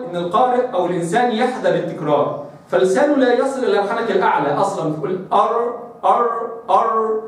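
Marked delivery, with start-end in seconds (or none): 5.3-7.2 أر with the tongue held short of the palate, no tap or trill; a timid أر, tongue never touching the roof; under-articulated r. Shown as a demonstration. Teacher mimicking a reciter afraid of trilling, a weak untapped r. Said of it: "لسانه مش واصل".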